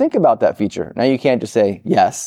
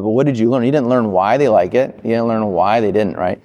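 ordinary delivery

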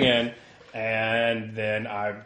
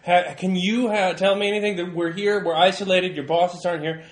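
raised, higher voice pressing for answers; insistent reporter; 4 semitones higher, 6 dB louder than usual. Acted. Reporter questioning a reluctant worker, insistent and raised.

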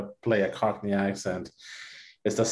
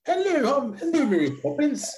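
very high, hammed-up mock Cockney; Lin-Manuel's Cockney accent; 14 semitones higher than usual. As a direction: badly fake a chirpy Cockney accent. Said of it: "It was very like".

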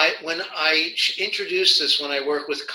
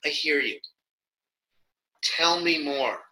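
empathic listening reply; 2 semitones lower, 6 dB quieter than usual. Shown as a demonstration. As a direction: hold back and just invite more, softer. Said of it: "they say".

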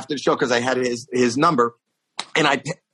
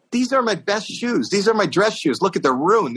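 aggrieved complaint; 8 semitones higher than usual. Retold or imitated. Retold complaint about ruined shoes.

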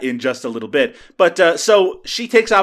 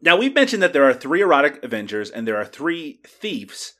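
usual speaking voice

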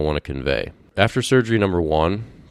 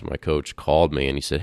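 ordinary talk, nothing marked

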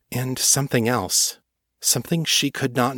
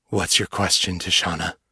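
thick rough voice; 4 semitones lower than usual. A narrator's reading. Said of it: "his voice now thick and rough".

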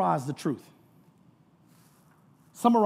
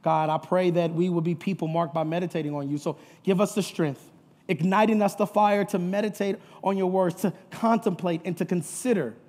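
prayer. Real, not acted. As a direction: lead the congregation reverently in prayer. Let's pray.

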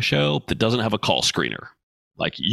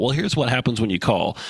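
ordinary talk, nothing marked

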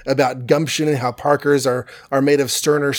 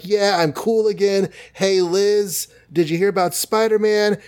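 higher cocky teen voice, lightly put on; imitating Flash; 6 semitones higher than usual. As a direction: play the show-off jock bragging, pitched up. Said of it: "Flash is like".

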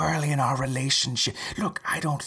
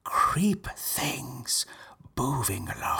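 urgent hushed whisper; whisper yelling; 3 semitones higher, much slower than usual. Real, not acted. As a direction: hiss it under the breath, pressing. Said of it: "why are you whispering?".

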